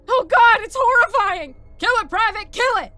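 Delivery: high, very loud panicked scream; screamed in terror. A narrator's reading. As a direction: shriek it out in horror. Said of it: "both screamed in terror".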